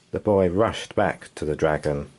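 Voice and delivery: ordinary delivery, nothing marked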